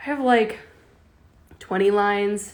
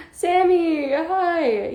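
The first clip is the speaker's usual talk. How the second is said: excited greeting; 9 semitones higher, 4 dB louder, much slower than usual. Real, not acted.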